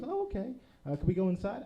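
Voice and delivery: put-on high little-girl voice; young niece. Niece asking to go inside the photo booth, high and childlike. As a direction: ask like an eager small child. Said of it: "And she goes".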